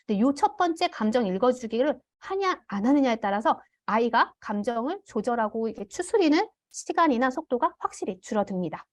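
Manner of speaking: ordinary delivery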